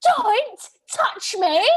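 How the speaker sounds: high, loud, drawn-out outburst; zero-to-a-hundred yell. Retold or imitated. Scottish woman warning off a groper on the dance floor, furious retold yell. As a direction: roar it slowly, each word stretched out. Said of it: "so I go zero to a hundred".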